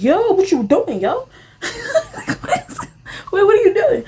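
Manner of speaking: high laughing "what you doing"; laughing disbelief. Retold or imitated. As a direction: laugh it out, caught off guard. Reacting to his move.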